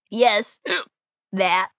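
low, broken by hiccups. Acted. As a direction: answer between hiccups. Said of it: "when I get the hiccups".

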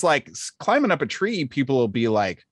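ordinary talk, nothing marked

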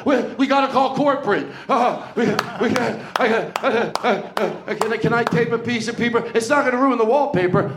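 high, halting mock panic; nervous stammer. Comedian mimicking scared festival staff over taping paper, nervous stammer. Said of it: "you all got nervous".

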